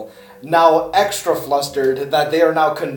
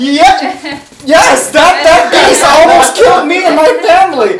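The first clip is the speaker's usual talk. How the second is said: much higher and louder, laughing through it; gushing Gertrude; 11 semitones higher, 12 dB louder than usual. Acted. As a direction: squeal it out, delighted and overacted. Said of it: "She's like".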